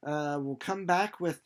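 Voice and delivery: normal talking voice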